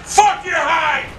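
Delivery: high-pitched cursing shout; angry shout. Real, not acted. Gamer cursing the incoming enemy helicopter, angry shout. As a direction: curse it out in real anger.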